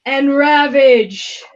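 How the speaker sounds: loud, slow ring announcement; announcer shout. Acted. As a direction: draw the name out for the crowd. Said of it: "Rough, gravelly voice, kind of a smoker".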